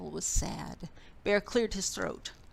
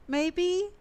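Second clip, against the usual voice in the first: high, small piping maybe; a guilty mouse hiding in the hall; tiny voice; 10 semitones higher, 4 dB louder than usual. A narrator's reading. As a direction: make the voice tiny. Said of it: "came a tiny voice".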